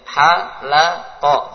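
"Halaqa" stretched very slowly syllable by syllable; broken up. Shown as a demonstration. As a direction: spell "Halaqa" out in slow separate beats. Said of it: "Putus-putus bacanya". ("Halaqa" dibaca putus-putus dan pelan)

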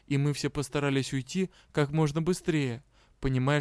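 plain reading voice

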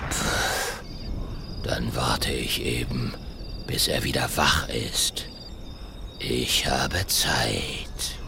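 low, soft, very slow muttering; muttering dinosaur. Acted. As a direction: mumble to yourself, resigned.

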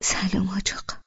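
soft, shaky whispered greeting; a timid bride barely getting the words out; trembling voice. A narrator's reading. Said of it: "با صدای لرزان گفتم". (با صدای لرزان و آرام)

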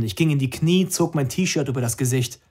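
usual speaking voice

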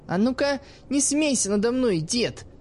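the girl sulking at her teacher's mockery; sulky girl. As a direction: pout and protest at the old man. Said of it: "насупилась искра".

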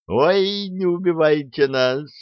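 high, frightened squeal; squealing in fright. A narrator's reading. Two soldiers begging the armed engineer not to shoot, squealing high. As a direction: beg for your life, shrieking. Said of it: "в голос заверещали".

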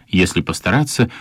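normal talking voice

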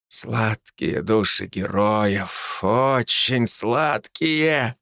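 slow, drawn-out greedy relish; possessed hungry voice. Acted. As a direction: savor each word like a hungry demon. Possessed necromancer savoring souls. (жадный одержимый голос)

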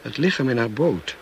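husband bursting out in distress. Acted. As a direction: blurt it out.

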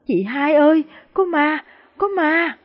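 high-pitched terrified cry to an elder sister; sobbing wail. A narrator's reading. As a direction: wail it out high, the last words drawn out. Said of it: "nức nở khóc rống lên". (khóc rống nức nở, hoảng sợ)

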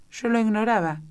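very polite. A narrator's reading. Alicia answering the duquesa, very politely. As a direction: insist politely, lifting the voice. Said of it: "muy cortésmente".

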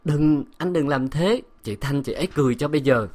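coy, teasing little plea; coquettish pleading. A narrator's reading. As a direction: whine sweetly through a pout. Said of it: "những lời nũng nịu".

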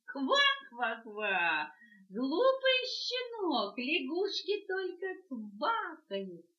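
high, loud, slow croaking taunt; mocking frog. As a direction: croak and mock, drawn out. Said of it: "лягушка".

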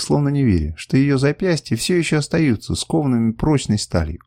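usual speaking voice

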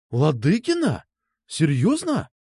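astonished question, voice climbing on the second word; stunned disbelief from a story character; wide-eyed surprise. A narrator's reading. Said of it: "У Насти глаза стали по полтиннику". (изумлённо, не веря своим ушам)

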